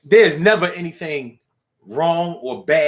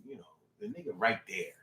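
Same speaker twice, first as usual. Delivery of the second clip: ordinary delivery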